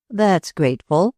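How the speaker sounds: usual speaking voice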